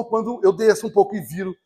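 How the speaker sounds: ordinary talk, nothing marked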